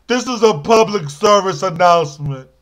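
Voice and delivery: ordinary delivery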